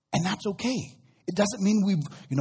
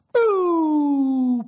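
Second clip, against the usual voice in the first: impact sound; 10 semitones higher, 6 dB louder than usual. Retold or imitated. Stone strikes Goliath's forehead.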